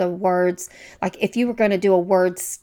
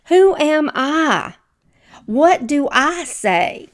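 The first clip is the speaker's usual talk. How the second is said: higher, slow, pleading self-doubt; imitating Moses as Cindy; 7 semitones higher, 3 dB louder, slower than usual. Preacher voicing a doubting Moses, higher and slow. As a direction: play the unsure Moses, voice pitched up and drawn out. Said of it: "it sounds just like Cindy".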